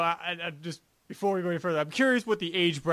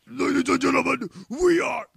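high, put-on stage announcer, last word stretched out; metal frontman impression; 10 semitones higher, slower than usual. Host imagining a death metal band's show, mock frontman introduction. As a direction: introduce the band like a metal singer, drawing it out.

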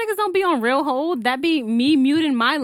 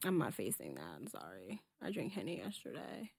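whispering; 11 semitones lower, 21 dB quieter than usual. Real, not acted. Refusing shot, whispering.

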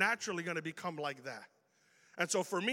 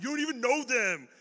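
raised, loud, defensive comeback; mad retort; 5 semitones higher, 6 dB louder than usual. Retold or imitated. Preacher imitating his younger self defending his friends, mad retort. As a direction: re-enact getting mad, higher and louder. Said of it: "I'd always get mad".

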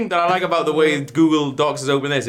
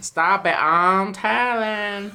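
put-on whiny child; 8 semitones higher, much slower than usual. Childish quarrel.

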